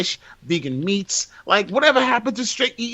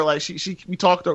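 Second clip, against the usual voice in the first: ordinary delivery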